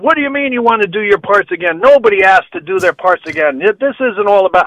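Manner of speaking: mock-indignant protest; retold indignant objection.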